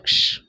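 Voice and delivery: a sharp loud hushing sound; loud shush. Retold or imitated. Boss silencing the rambling gardener with a shush. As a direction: cut him off with a shush.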